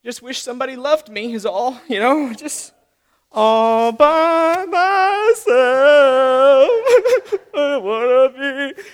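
slow, hammed-up self-pity with a fake sob; a sulker moping by the window, hammed-up crying; mock sulking whine. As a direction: wallow dramatically, tongue in cheek. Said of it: "Yeah, I just".

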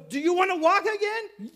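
loud, high, insistent question; acted shouted challenge; Jesus challenging the man. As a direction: fire the question at full volume. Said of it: "Jesus looks at him and goes".

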